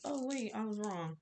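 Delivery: ordinary talk, nothing marked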